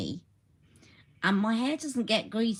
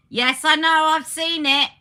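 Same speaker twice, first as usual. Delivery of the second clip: exasperated shout; 10 semitones higher, 12 dB louder than usual. Real, not acted. Snapping at someone off camera.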